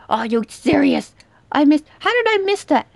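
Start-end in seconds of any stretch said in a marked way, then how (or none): 1.5-2.9 high, fast, exasperated; real gamer outrage at a miss; frustrated disbelief. Real, not acted. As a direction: blurt out in disbelief. Missing a shot in frustration.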